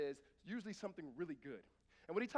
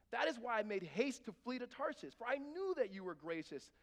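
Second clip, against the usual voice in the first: louder, earnest reading of the prayer; scripture reading; 3 semitones higher, 6 dB louder than usual. Acted. Preacher reading Jonah's prayer to the Lord, earnest. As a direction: read Jonah's prayer with feeling.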